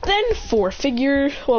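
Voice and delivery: ordinary delivery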